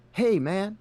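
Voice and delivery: high, loud dude voice; prank recording voice. Retold or imitated. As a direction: call out like a stoner prankster. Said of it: "it was like".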